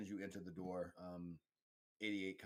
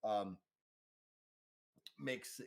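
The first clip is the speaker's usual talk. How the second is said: ordinary delivery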